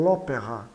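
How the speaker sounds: "l'opéra" run together as one word; "l'opéra" with no A after the L; elision. Shown as a demonstration. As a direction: join the L straight onto the O in "l'opéra". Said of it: "A elisão".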